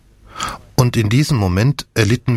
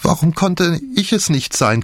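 high lamenting wail; tragic heroine sorrow; 9 semitones higher than usual. A narrator's reading. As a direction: lament it with despair. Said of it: "klagte sie".

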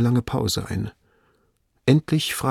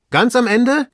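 high, loud, rising question; master's excited query, rising on Ende; astonished echo; 13 semitones higher, 6 dB louder than usual. Acted. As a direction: echo it back in disbelief. Master echoes the report, astonished.

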